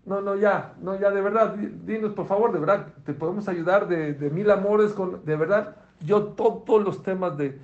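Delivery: high, loud, insistent pleading; won't take no for an answer; loud, eager, pushing help on him; pushy eager offer. Retold or imitated. President eagerly presses his help.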